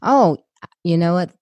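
usual speaking voice

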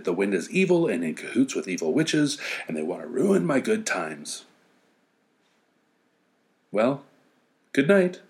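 plain reading voice

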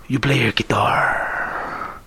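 put-on low, slow whisper with Spanish accent; Antonio Banderas voice. Ending the Banderas impression with a slow low whisper. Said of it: "Antonio Banderas".

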